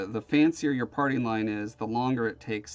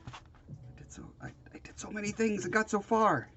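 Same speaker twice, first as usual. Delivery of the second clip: breathy whisper, lamenting; 9 semitones higher than usual. Real, not acted. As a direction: whisper it like a sad confession.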